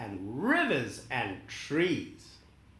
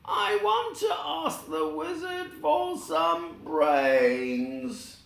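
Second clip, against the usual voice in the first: high, slow, hopeful voice; long for what you lack; Scarecrow wishing; 11 semitones higher, slower than usual. Acted. The Scarecrow wishing for brains from the wizard, high and slow. Scarecrow explains his wish.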